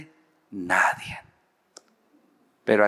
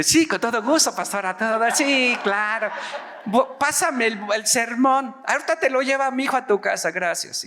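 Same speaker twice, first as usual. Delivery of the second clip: hammed-up high, gushing yes; over-eager agreement; 12 semitones higher than usual. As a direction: agree far too willingly, pitched way up. Acts over-eager agreement to pastor. (entusiasmo exagerado y agudo)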